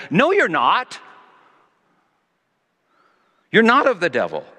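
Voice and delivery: firm, emphatic denial, loud; Jesus firmly contradicting the speaker. Retold or imitated. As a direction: insist firmly, no room for doubt. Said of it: "very emphatically".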